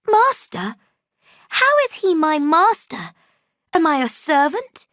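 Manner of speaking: high, loud indignant question; defiant protest. A narrator's reading. Girl defies the maid, high and loud. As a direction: throw the word master back.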